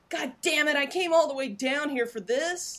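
mock exasperation at a wasted trip; exasperated cop. Police officer griping about a wasted trip, mock exasperated.

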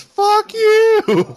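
high, loud mocking yell of the reviewer; hammed-up cursing burst; mock outburst. Friend mocking the reviewer with a yelled curse.

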